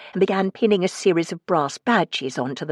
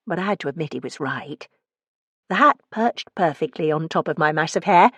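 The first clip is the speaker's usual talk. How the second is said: ordinary delivery, nothing marked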